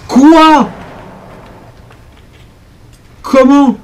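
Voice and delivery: loud, high indignant questions; demanding an explanation, incredulous; outraged disbelief. Real, not acted. Player demanding the game explain itself, loud indignant disbelief.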